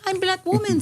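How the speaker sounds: very high mock German accent; Martina Big. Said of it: "after Martina Big came out when they're just like".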